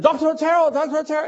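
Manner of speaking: calling out. Retold or imitated. Woman calling the doctor outside.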